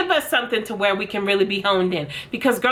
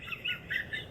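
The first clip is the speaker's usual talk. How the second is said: shrieking laugh, very high and soft; helpless high-pitched giggle; high squeal of laughter; 16 dB quieter than usual. Real, not acted. Watching a crying MLM video.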